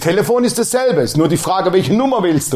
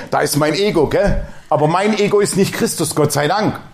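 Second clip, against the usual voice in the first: ordinary talk, nothing marked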